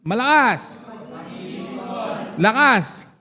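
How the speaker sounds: short, forceful call to the crowd. Real, not acted. Demanding louder reading from congregation.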